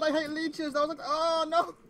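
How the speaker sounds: high and loud, still rattled; panicked. Real, not acted. Player still shaken by the leech, high panicked. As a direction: blurt it out fast in fright.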